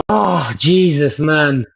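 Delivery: ordinary talk, nothing marked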